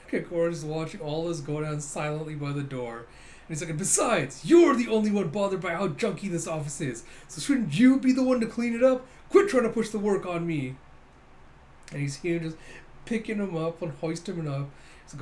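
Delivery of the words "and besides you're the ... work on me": loud, rising mock protest; indignant arguing. Captain refusing to clean his office, hammed-up indignation. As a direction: push the blame back, higher and louder.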